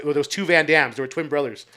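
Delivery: ordinary talk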